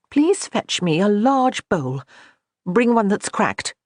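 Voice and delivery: slow, deliberate request; measured order. Acted. Johanna calmly instructing Megan to fetch a bowl, slow and measured. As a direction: lay out each word calmly, slow.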